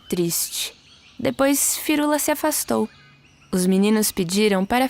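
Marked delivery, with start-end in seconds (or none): none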